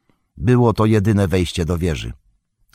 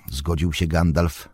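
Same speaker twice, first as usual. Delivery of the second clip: ordinary delivery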